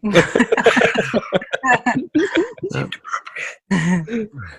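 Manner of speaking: long amused laugh; bursting laugh. Real, not acted. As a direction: let out a laugh. Laughing after whispering.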